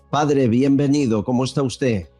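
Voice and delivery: ordinary talk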